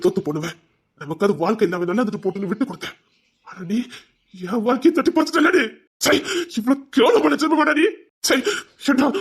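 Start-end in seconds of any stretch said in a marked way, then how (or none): 4.3-9.1 villain raging at the man who stole his life; furious outburst. Acted. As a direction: scream your disgust at him.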